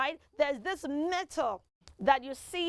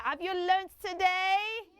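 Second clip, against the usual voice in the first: ordinary talk, nothing marked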